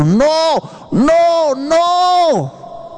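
long, high-pitched mock shout of NO; grito imitado. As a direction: cry out each no, drawn out and high. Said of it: "Dios está gritando". (grito fingido, largo y agudo)

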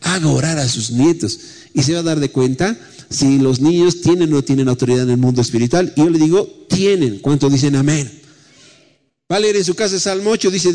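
lively preacher to congregation; preaching. Acted. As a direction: preach with rising fervour. Preacher sermon delivery.

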